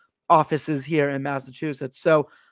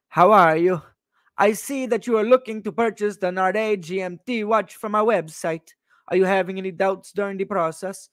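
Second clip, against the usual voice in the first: put-on Alessandro's foreign accent. Watch seller offering help to a customer, mock foreign accent. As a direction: read it out in a hammed-up foreign accent. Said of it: "I'll do it in the accent".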